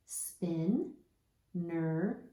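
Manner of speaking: "Spin" and "ner" as two separate chunks; chunked syllables. Shown as a demonstration. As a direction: read "Spin, ner" with a clear gap. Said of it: "Let's read our two chunks".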